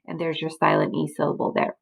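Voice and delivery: usual speaking voice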